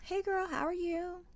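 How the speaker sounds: high, soft company voice; specific polite Melissa. Retold or imitated. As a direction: put on a sweet in-law voice. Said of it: "I give her a very specific Melissa".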